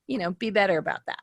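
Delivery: usual speaking voice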